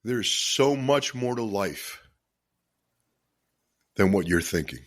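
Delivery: fighting back tears to keep talking to listeners; choked up with emotion. Real, not acted. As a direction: voice catching, long pauses between phrases. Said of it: "I get pretty emotional about this stuff".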